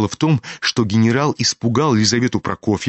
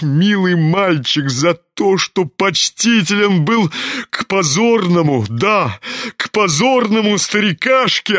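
declaimed blessing turning to curse; halting, theatrical fervour, rising in pitch; emotional old general; 8 semitones higher, slower than usual. A narrator's reading. General blesses his son, then curses, deeply emotional.

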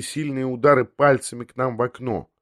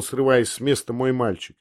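ordinary delivery, nothing marked

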